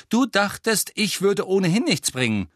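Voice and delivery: high, defensive challenge; angry accusation. A narrator's reading. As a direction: cut in with a heated retort. Ron interrupts in quarrel.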